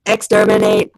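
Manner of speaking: low harsh Dalek screech. Pretend. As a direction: grind it out like a Dalek. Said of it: "can you say exterminate".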